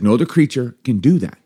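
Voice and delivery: usual speaking voice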